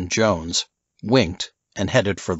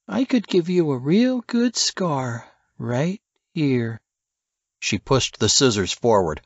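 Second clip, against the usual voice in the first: menacing flirt; 4 semitones higher than usual. Acted. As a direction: purr the threat like a sweet game. Alice threatening with scissors.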